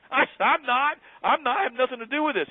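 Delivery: high-pitched protesting of innocence; defensive protest. Retold or imitated. Retold protest of own innocence.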